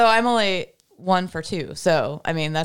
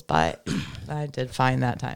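ordinary talk

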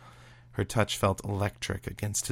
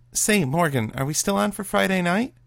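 much higher, louder friendly call back; chummy; 11 semitones higher, 5 dB louder than usual. Acted. Kate calling back from the door to her friend, chummy. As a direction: switch to bright and chummy. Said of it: "abrupt shift from stern to chummy".

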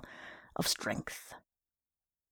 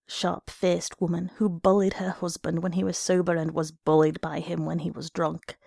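ordinary delivery

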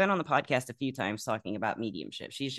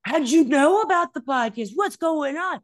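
gasping, much higher and louder; shocked; 8 dB louder than usual. Retold or imitated. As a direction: blurt it out in total astonishment.

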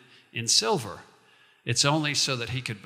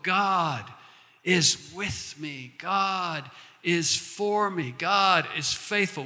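slow creed with long falls; slow declaration; 2 semitones higher, slower than usual. Shown as a demonstration. Pastor modelling the confession for the congregation, slow and firm. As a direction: lay each phrase down slowly. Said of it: "Will you say it with me?".

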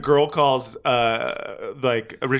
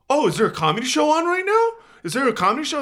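mocking the couple; 12 semitones higher, faster than usual. Couple asking about the comedy show, mock high bro voice. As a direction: ham up their fake surprise. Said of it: "they're like".

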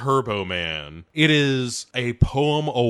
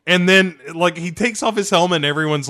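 normal talking voice

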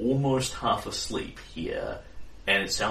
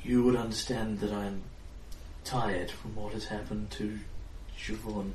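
tired distant fairy queen; 5 dB quieter than usual. Acted. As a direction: speak as a weary queen drifting off, far away. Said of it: "She sounds very tired and very far away".

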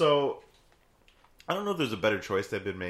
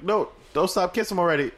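mock coast guard; 9 semitones higher, 3 dB louder than usual. Coast Guard voiced in story.

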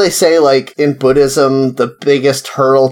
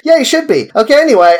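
high, bright "Yeah, you should be"; sweet, higher voice that hides a jab; curt mock cheer; 11 semitones higher, faster than usual. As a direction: brush off a late coworker with sugary scorn. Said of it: "And I'm just like".

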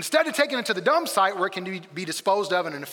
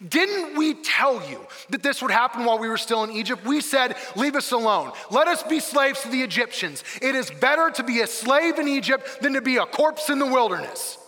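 raised, indignant I-told-you-so; dripping with sarcasm; 6 semitones higher, 3 dB louder than usual. Acted. Israelites telling Moses I told you so, bitterly sarcastic. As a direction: throw it back at him, higher and accusing. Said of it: "dripping with sarcasm".

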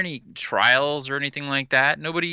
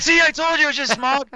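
much higher, louder mock creature voice; pitched-up teasing creature voice; Mythosaur voice; 13 semitones higher, 6 dB louder than usual. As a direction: gloat as the Mythosaur. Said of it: "it'll be like".

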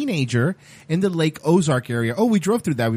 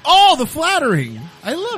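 high mock delight; mock gushing; 11 semitones higher, 4 dB louder than usual. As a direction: gush over it, hammed-up.

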